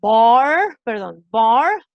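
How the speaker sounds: "bar" loud and drawn out with untrilled final R; "bar" ending on a tongue-back English R; English throat R. Shown as a demonstration. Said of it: "así digo".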